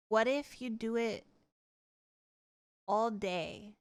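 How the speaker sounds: ordinary delivery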